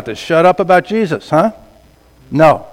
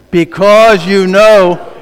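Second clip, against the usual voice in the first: loud, slow, each word spelled out; louder congregation reading; 5 dB louder, much slower than usual. Shown as a demonstration. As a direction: read it back with full force. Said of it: "One more time".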